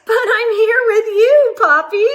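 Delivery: put-on high, loud childlike protest; child's reply. Child answering Poppy through the window reflection, high and insistent. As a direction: insist brightly you're right here. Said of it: "And I say".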